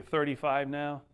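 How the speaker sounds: ordinary talk, nothing marked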